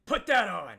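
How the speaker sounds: high, loud drillmaster's command; barked order. Acted. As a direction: shout an order at a recruit. He goes.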